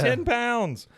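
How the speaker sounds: high, loud disbelief; amazed exclamation. Real, not acted. Host marvels at the pile of treats, loudly amazed. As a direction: exclaim it, pitch leaping up and falling.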